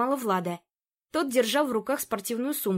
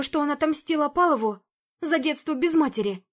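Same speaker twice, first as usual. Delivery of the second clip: flustered housekeeper; 4 semitones higher, 4 dB louder than usual. Acted. Zina explains to investigator.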